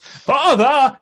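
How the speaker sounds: high, loud theatrical cry; Matt Berry. Acted. Streamer demonstrates a comedian's voice, loud mock outburst. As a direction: boom it out as a hammed-up comic actor's exclamation. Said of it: "Matt Berry is only a couple of octaves deeper".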